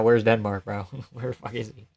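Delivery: ordinary talk, nothing marked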